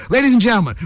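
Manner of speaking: ordinary delivery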